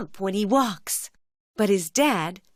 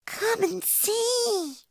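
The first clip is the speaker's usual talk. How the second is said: high, slow and softer cub voice; little bear voice; 12 semitones higher, 4 dB quieter, slower than usual. Acted. Little Brown Bear greeting dad home in the evening, high slow cub voice. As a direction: invite him in gently like a small cub. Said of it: "Little Brown Bear says".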